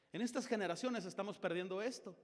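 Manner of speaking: ordinary talk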